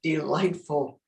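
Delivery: plain reading voice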